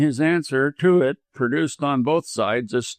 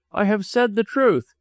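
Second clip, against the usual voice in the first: higher, insistent assertion; dogged; 8 semitones higher than usual. A narrator's reading. Doctor stands by his declaration, dogged. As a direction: insist on it stubbornly, pitched up. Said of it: "replied Ellis doggedly".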